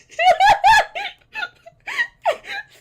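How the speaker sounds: very high, very loud shriek of laughter. Real, not acted. Player howling at the joke, shrieking laugh. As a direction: howl with laughter.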